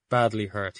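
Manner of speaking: ordinary delivery, nothing marked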